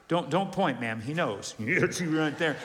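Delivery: ordinary talk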